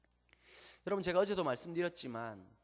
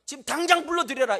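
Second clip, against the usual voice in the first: loud, high royal order; kingly command; 8 semitones higher, 11 dB louder than usual. Acted. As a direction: bellow it like a king summoning his men. Preacher voices David's royal command. (높고 크게 외치는 왕의 명령)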